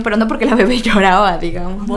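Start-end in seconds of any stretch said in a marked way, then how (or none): none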